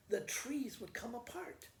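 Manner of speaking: ordinary delivery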